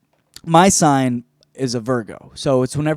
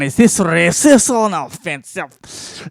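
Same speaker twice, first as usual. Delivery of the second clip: slow put-on Slavic accent; mock Slavic accent; slower than usual. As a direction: ask it in a hammed-up fortune-teller accent. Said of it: "I'm not good with accents".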